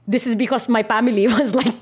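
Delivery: Filipino accent with a laugh inside. Shown as a demonstration. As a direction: keep the Filipino accent while chuckling. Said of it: "this is a Filipino accent".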